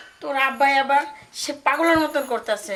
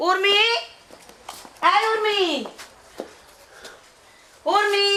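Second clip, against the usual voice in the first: high-pitched calling out; yelling for someone; 7 semitones higher than usual. Acted. Mother calls her daughter from outside, yelling. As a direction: call the name out high, held level. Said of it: "এত চিল্লাইতাছো কেন?".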